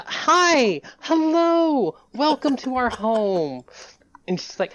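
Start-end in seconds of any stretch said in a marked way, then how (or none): 0.0-3.6 high, loud, gushing hostess welcome; high, bright greeting, slowing on the welcome; over-bright greeting. Acted. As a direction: throw the door open and gush. Host greeting guests at door.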